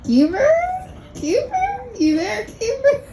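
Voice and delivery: high, sing-song calls of Kiefer; calling for Kiefer. Retold or imitated. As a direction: call out for Kiefer like a flirty fan. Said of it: "She's like".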